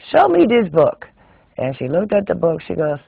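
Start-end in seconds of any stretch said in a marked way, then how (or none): 0.1-1.1 put-on high, loud scolding old-school knitter; bossy exclamation at a pupil's mistake; scolding Swedish teacher. Swedish teacher demanding the book.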